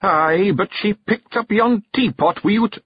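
a dim wee pixie piping up; Scottish pixie. A narrator's reading. A pixie points out the teapot, Scottish voice. Daft Willie interjects.

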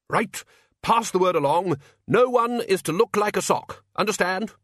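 high, loud officer's orders; commanding Archchancellor. Acted. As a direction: rap out orders to the troops.

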